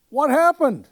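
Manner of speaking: very high, loud alarmed shout; yelled down. Retold or imitated. Cast shouting down the stairs, loud and high. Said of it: "they yell down".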